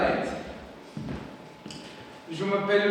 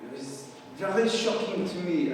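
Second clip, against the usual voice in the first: ordinary talk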